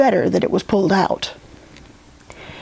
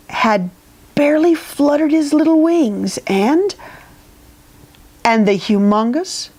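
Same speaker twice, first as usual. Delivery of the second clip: plain reading voice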